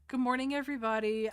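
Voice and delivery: loud, fast, upbeat welcome; cheery tour guide greeting. Acted. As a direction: greet the crowd brightly and quickly.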